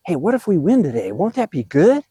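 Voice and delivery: upbeat, swooping pep-talk question; acted excitement. Shown as a demonstration. Coach showing players how to act excited, upbeat pep talk. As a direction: pitch the idea like acted good news. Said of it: "you have to act excited".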